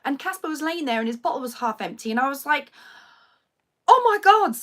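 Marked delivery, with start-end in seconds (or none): 3.9-4.5 loud, high burst of shock; shocked gasp. Retold or imitated. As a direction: blurt it out as you see him. Finds her son in dream.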